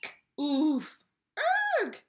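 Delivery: a burst of loud pig shrieks and grunts; hammed-up pig chorus of eek, oof and erg; pig squeals and grunts. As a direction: yelp out each pig noise, the middle one loudest. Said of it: "a chorus of grunts and shrieks".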